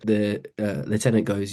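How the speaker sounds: ordinary delivery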